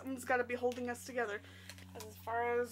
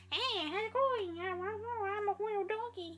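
cartoon dog; 8 semitones higher than usual. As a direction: voice a goofy little cartoon dog. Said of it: "he looks like he's".

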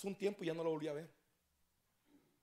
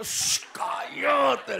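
slow, loud high shush; loud hushing; 8 semitones higher, 11 dB louder, much slower than usual. Acted. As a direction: hush her loudly and slowly. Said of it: "le dijo".

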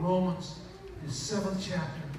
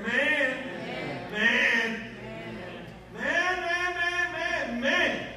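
repeated call; 6 semitones higher, 5 dB louder, much slower than usual. Acted. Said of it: "Say it again".

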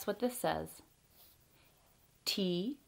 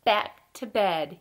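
mild storybook yell; 6 dB louder, much slower than usual. A narrator's reading. Fred answers Ted's call to bed, slow mild yell. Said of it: "yelled Fred".

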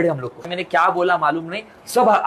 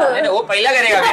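laughing; 6 semitones higher than usual. Real, not acted. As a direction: answer through a laugh. Laughing at marriage question. (हँसते हुए जवाब)

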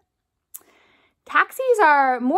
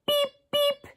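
much higher squeaky beep beep; horn beep; 12 semitones higher, 3 dB quieter than usual. Shown as a demonstration. Teacher shows the kids the taxi horn beeps. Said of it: "I want you to say".

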